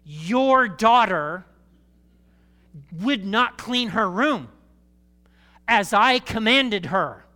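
loud, slow, mock-formal scolding from the wife; hammed-up wife blaming the husband; wife's stern accusation. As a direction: drag out each word like an outraged wife. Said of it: "Jenny will say to me".

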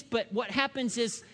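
ordinary talk

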